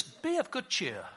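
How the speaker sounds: a touch of polite British; mock British. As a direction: quote it with a hint of British. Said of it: "It sounds so British".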